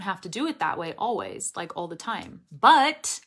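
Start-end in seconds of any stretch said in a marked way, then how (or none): 2.5-3.2 BUT high and loud with a crisp released T; emphatic released T. Shown as a demonstration. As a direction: punch "But" and release the final T. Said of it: "see, there I did release it".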